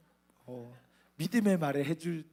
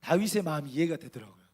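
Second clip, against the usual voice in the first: ordinary talk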